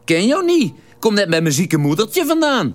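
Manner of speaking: lightly voiced Venlo lout; Venlo dialect. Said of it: "Alles steeds in vuig plat Venloos".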